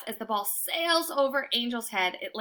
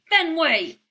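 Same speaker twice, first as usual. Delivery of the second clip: louder, higher warning tone; very mad voice; 6 semitones higher, 8 dB louder than usual. A narrator's reading. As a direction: say his name like he's in trouble. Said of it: "a very mad, "you're in trouble" voice".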